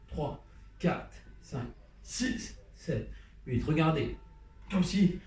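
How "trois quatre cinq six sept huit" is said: whispered count, softer. Shown as a demonstration. Teacher whispering the count while dancing. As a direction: breathe the numbers, barely voiced.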